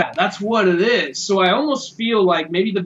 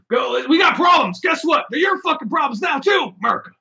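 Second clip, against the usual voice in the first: mock shouting nation; 8 semitones higher than usual. Voicing America yelling at the world, brash mock shout. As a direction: bellow it like a brash loudmouth passing the buck.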